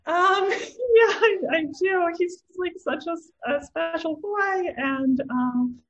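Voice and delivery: high, choked-up grandmother voice; a grandma fighting tears; tearful grandmother. Retold or imitated. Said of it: "she's like".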